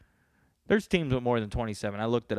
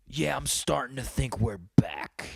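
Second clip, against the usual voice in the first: loud raspy stage whisper; 2 semitones higher, 4 dB louder than usual. Acted. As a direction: rasp it out in a hushed, forceful voice. Quoting a movie line to himself.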